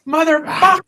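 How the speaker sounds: high, raging curse; furious shout. Retold or imitated. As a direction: imitate him exploding at the car.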